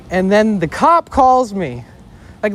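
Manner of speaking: usual speaking voice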